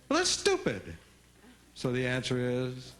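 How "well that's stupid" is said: put-on high, indignant kid retort; mad kid. A kid getting mad at a parent, high indignant retort. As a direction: snap back like an annoyed kid. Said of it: "Could get mad".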